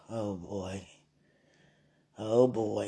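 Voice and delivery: low, soft mock groan of dismay. Imitating a listener sitting there, mock dismay. Mimics a listener's weary look.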